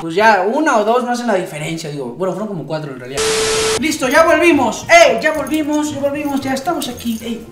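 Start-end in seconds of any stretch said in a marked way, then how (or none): none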